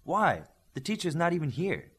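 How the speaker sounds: a touch of the restless hothead; defiant protest. Acted. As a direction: push back at the order, challenging. Olympian protests to the leader.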